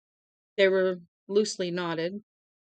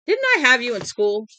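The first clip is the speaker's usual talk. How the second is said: stern teacher; 4 semitones higher, 8 dB louder than usual. Acted. Stern old teacher questioning.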